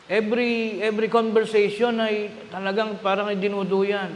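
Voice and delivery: normal talking voice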